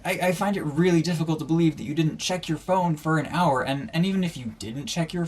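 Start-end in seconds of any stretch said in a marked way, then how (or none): 0.1-3.6 exasperated. Retold or imitated. Continuing retold rant at him.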